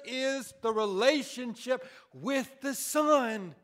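loud preacher's rise; loud impassioned sermon; preaching at full force. Acted. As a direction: preach it to the back pews.

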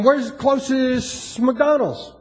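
slow, careful words to the phone; asking the phone slowly; slow voice command. Shown as a demonstration. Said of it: "I say".

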